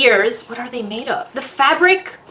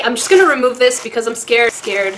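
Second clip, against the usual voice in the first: normal talking voice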